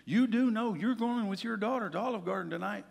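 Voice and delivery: a hint of a stern wife reminding; wife's reminder. Wife reminding husband of the dinner, mildly stern. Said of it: "She goes".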